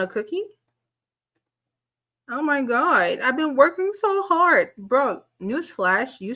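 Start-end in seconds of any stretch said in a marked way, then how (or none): 2.3-4.7 high, sarcastic imitation of a self-pitying man; mocking whine. Mocking the rapper who wants praise for working, in a high sarcastic whine. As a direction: mimic him fishing for praise, pitched up.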